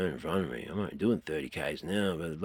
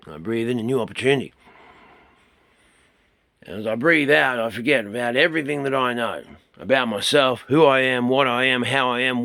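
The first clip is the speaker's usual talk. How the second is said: loud, higher breathing exercise voice; guided breathing; 5 semitones higher, 8 dB louder than usual. Shown as a demonstration. Teacher leading students through a breathing exercise, loudly guided. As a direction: lead them through each breath with force.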